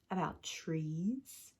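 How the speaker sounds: low, slow, soft pointed question; knowing tease. Acted. Mama needling Papa about his dream of trees, low and slow. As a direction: let it hang, slyly.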